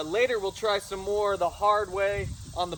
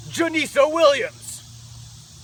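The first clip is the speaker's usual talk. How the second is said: fight announcer; 9 semitones higher, 8 dB louder, much slower than usual. Acted. Calling out a fighter by name.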